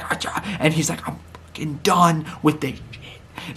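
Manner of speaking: hushed, voiceless retold whisper; mock whisper. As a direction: whisper the angry words like a secret. Retelling an angry backstage exchange.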